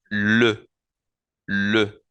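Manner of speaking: le with the French rounded E, said slowly; le with rounded lips, like the French E; French article le. Shown as a demonstration. Teacher demonstrating the French E in le. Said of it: "Suena con la E francesa".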